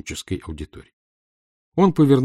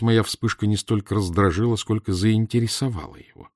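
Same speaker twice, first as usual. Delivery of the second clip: plain reading voice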